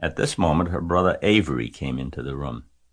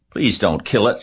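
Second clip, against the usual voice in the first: put-on higher, louder pleading girl, a touch of sob; girl begging her father to spare the pig; sobbing plea; 5 semitones higher, 5 dB louder than usual. A narrator's reading. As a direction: beg her father through tears. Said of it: "she sobbed".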